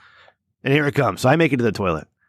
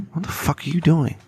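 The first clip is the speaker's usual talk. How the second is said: mock angry wife; 4 semitones higher than usual. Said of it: "she got, like, violently angry at me".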